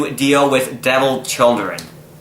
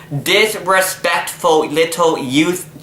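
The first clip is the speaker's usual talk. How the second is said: slow disgust; 2 semitones higher, much slower than usual. Acted. Mock disgust at the kid.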